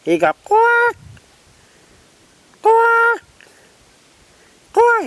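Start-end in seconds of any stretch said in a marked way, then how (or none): 0.4-1.0 very high single squawk; bird yelling out. Retold or imitated. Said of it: "He yelling out". 2.6-5.0 very high single squawk; bird yelling out. Retold or imitated. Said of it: "He yelling out".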